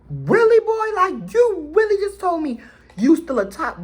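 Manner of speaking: loud, high-pitched disbelief, acted out; incredulous outburst. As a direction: replay being loudly floored by the confession. Retelling a shocked confrontation.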